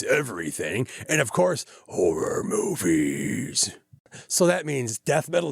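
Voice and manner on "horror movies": slow, low, eerie drawl; spooky voice. Acted. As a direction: stretch it out like a horror trailer. Spooky Halloween intro.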